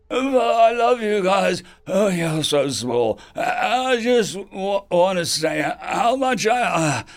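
slow, loud, choked-up rambling; tearful drunk. Acted. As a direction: blubber it out, halting and sniffling. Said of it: "said with a sniffle".

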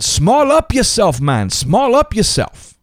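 put-on high, slow Jamaican-accented cry; Jamaican accent scream. Bishop to friends crammed in a speeding van, Jamaican-accented mock scream. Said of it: "in a Jamaican accent".

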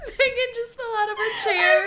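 high squealing laughter; can barely talk through laughs; laughing hard. Real, not acted. Both crack up laughing.

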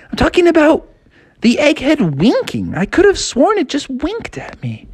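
put-on high, insistent boy voice; Froggy voice. Froggy insisting to his sister, high voiced. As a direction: pitch it way up, insisting. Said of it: "And Froggy's like".

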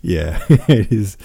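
chuckling through the words; laughing. Real, not acted. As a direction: laugh it off, amused. Amused by chat comment.

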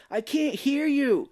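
high, slow mock shout; stretched-out, high-pitched phone yell; imitating a bad phone call. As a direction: holler it as if to someone hard of hearing. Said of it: "It's like talking to my parents".

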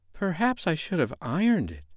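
mildly pensive second thought; thoughtful. A narrator's reading. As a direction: wonder aloud thoughtfully. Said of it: "Wendy said thoughtfully".

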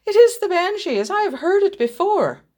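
high, loud, emphatic declaration; urgent insistence. A narrator's reading. Sick boy insisting to the doctor, urgent and high. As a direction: insist on it, pitched high and forceful.

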